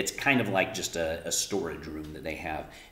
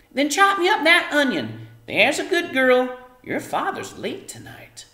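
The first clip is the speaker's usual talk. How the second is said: much higher, louder motherly voice; mother's voice; 10 dB louder than usual. Acted. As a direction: give the order like a busy mum. Homily speaks to her daughter.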